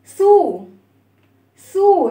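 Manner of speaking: سو with a long rounded "oo"; rounded lips. Shown as a demonstration. Teacher shows children how lips close for the sound, rounded سو. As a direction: round the mouth and hold سو as "soo". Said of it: "بتضم الفم".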